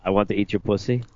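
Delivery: low, seductive line; low magnetic voice. Acted. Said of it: "your voice is so sexy".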